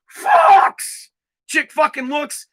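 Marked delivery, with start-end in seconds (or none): 0.1-1.1 very high, drawn-out holler; yelled catcall. Acted. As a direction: yell it out the car window like Dad. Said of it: "just yells".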